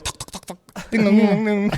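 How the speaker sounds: tongue-clicked knocks into quick ding-dongs; rapid-fire mouth sound effects; imitated knocking and doorbells. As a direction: mimic knocking, then doorbells ringing.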